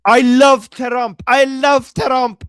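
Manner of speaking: loud, slow, drawn-out chant; imitated cheering. Echoing the boy's shouted cheer.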